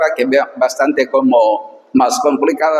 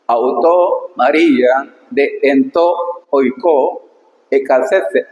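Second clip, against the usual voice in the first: slow, careful reading of ancient Greek; slower than usual. Shown as a demonstration. Teacher reading the Greek verse to students, slow and careful. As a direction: read each word apart for the students.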